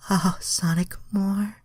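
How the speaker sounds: high, breathy mock moaning plea fading to a whisper; hammed-up high moaning voice; moaned. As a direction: ham up a high moan. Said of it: "Starfire moaned".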